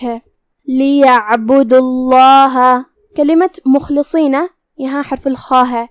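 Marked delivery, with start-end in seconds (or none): 0.7-2.8 slow لیعبدو اللہ with a full, heavy لام in اللہ; heavy Allah. Shown as a demonstration. Tajweed teacher demonstrating tafkhim for students, slow and heavy. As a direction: read اللہ thick and full, slowly. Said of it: "لفظ الجلالہ کا حکم ہے تفخیم".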